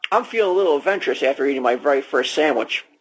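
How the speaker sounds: fast, rattled-off line; rushed read. Acted. Dad stumbling through the ad script, fast. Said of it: "didn't know how to pronounce anything".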